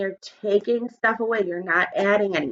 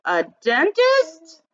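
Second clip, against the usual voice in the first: tooth fairy; 6 semitones higher, much slower than usual. Acted. Tooth fairy voiced in story.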